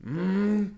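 high imitated outboard engine "mmm"; boat motor hum.

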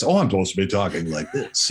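mock put-on announcer voice; lightly hammed-up broadcaster; affected broadcaster voice. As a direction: imitate a fake radio voice.

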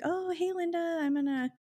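high, sing-song hello; chirpy phone greeting. Retold or imitated. Girlfriend ringing boyfriend's mom, sweetly casual.

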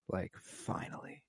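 breathy whisper of relief; a faint, released sigh of a word; whispered exhale. Retold or imitated. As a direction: let the word go out on a last breath. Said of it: "a relieving exhale".

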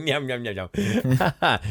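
slow, drawn-out nyam sounds; slow munching noises. Pretend. Echoing his friend's eating joke, slow munching noises. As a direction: chew out each nyam lazily.

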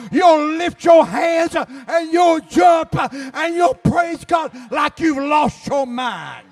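hollering preacher bringing it home; full-force preaching. Acted. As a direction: build the congregation up to a roar.

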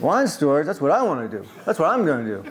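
animated Greek-accented impression; thick Greek accent. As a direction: exclaim as an excited Greek owner, accent lightly imitated. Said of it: "he had a thick accent".

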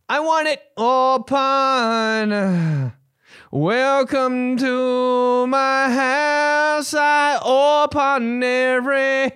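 high, slow rock croon; rock singer voice. Pretend. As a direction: stretch every word like a ballad. Keeps the singer voice bit.

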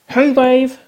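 loud high call from a distance. Acted. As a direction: shout it across the room. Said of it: "Nicole's muffled voice called out".

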